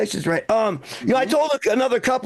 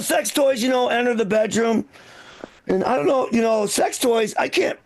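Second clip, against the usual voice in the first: usual speaking voice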